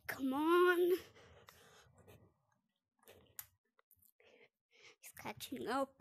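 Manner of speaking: breathless whisper while fleeing; being chased. Real, not acted. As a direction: whisper urgently, running away.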